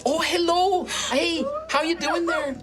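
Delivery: put-on high, chirpy Canadian accent; friendly Canadian shop lady greeting. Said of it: "That's Canadian".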